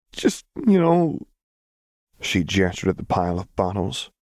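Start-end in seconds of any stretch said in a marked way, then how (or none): 0.1-1.3 high, slow words wavering after crying; shaky, tearful. A narrator's reading. Vi explaining herself after crying, shaky and tearful. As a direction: get it out on a shaky breath. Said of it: "then took a shaky breath".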